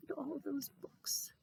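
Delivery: whispered, wistful; longing whisper. Acted. Rabbi gazing at his books, hushed and longing. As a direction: breathe it like a secret wish.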